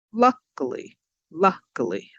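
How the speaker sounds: luckily with LUCK punched; careful demo of LUCK-ily; stress on first syllable. Shown as a demonstration. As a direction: go up on LUCK, fall through luckily. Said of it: "up on the first".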